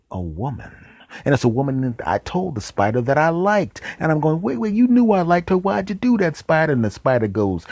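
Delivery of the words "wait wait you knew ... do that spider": high, loud comic whine of betrayal; mock-indignant, high-pitched pleading; hurt protest. Acts out protest at spider stealing crush.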